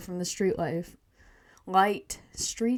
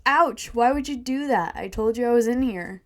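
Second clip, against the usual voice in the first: loud, higher-pitched indignant complaint; hurt and outraged, raised voice; mock scream; 4 semitones higher, 6 dB louder than usual. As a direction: cry out in protest, not a full scream. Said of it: "Jerry screams".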